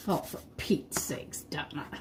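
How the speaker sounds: breathy whisper, no voice; whispered self-scolding. Real, not acted. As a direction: mutter it to yourself under the breath. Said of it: "repeats exasperation, whispered".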